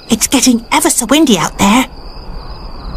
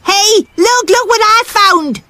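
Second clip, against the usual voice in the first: high eager call; excited child; 9 semitones higher than usual. Acted. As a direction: show it off proudly. Child shows off a find.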